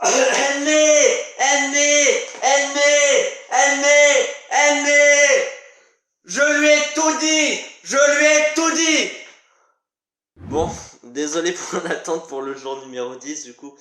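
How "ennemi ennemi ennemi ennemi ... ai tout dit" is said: high, loud cries hammered out on one pitch; full-force stage shout, breakdown climax; frenzied shouting. Acted. Character calling out that he told everything, frenzied shout.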